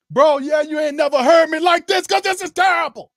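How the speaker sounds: high, loud shout in his friend's voice; voicing Rashad shouting. Rashad shouting agreement to the host, high and loud. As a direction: shout back as Rashad, fed up. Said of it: "Look at brother Rashad here".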